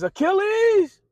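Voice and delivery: high, loud summoning call. Retold or imitated. King summons his champion before both armies, loud high call. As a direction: bellow the name across the field.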